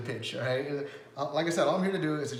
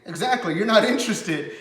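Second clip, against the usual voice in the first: laughing; 8 semitones higher, 10 dB louder than usual. Retold or imitated. Rep teasing, laughing mid-line.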